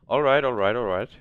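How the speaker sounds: loud triumphant all right; excited shouting. Real, not acted. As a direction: cheer it out loud. Game success, celebrating.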